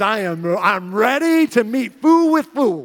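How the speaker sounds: hammed-up fighting talk; fired up. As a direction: build up like you are itching to cause a scene. Acting out himself itching to fight.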